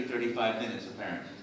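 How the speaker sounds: ordinary delivery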